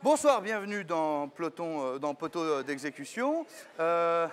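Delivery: presenter voice, a touch formal; welcoming guest on the show; mock TV host intro. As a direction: open the show like an anchor.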